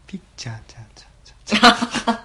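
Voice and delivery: whispered echoing PA voice; stadium echo. Pretend. Mocking stadium announcer echo. (球場アナウンスの反響をまねて)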